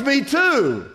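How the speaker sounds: high, loud, eager acted reply; thrilled to find common ground; hammed-up joke-teller excitement; excited agreement. Passerby delighted at match.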